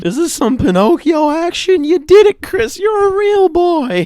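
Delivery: excited squeal of fake wonder; mock amazement. Real, not acted. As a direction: gush like a Pinocchio ending.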